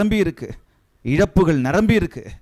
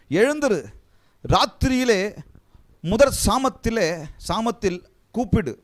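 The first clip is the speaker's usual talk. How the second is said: scripture reading; 6 semitones higher than usual. Acted. Reads verse with higher pitch.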